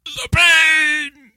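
high, drawn-out mock agony; William Shatner, dying sheep. Co-host performing on command, high hammed-up death throes. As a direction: wail each phrase like a dying ham actor. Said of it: "William Shatner, dying sheep, go".

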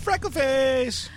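high, slow sung-out name; excited call. Real, not acted. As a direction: call her name out gleefully. Calls out her nickname.

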